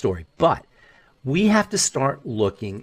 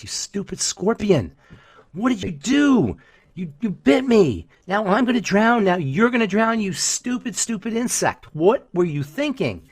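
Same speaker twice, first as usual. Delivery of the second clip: high, slow, indignant frog yelling at the scorpion; a frog pitched higher, outraged at the scorpion who doomed them both; angry frog; 8 semitones higher than usual. Retold or imitated. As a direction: scold the scorpion as you sink, pitched up. Drowning frog berates scorpion.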